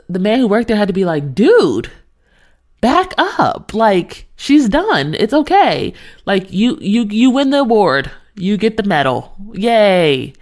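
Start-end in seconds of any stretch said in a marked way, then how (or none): 1.3-10.3 exasperated, high-swinging retold scolding; frustrated. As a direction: tell someone off with sarcastic congratulations at the end. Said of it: "you see how frustrated I still am".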